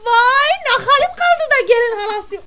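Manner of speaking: mother of the bride moaning about her dress; mock wail. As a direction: wail it out over the ruined dress. Wailing over her ruined dress.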